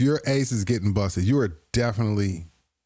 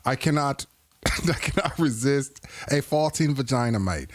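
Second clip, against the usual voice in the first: mock British accent. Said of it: "I think I'm mixing British with Australian".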